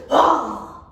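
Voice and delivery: loud startled cry, then a slow holy moly; old man jumps at a raccoon; yelp, then stretch out holy moly; startled exclamation. Acted. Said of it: "when something exciting happened".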